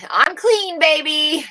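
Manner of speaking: high, loud playful outburst; pleased, punchy shout-out; playful exclamation. Real, not acted. Playful exclamation in own chat.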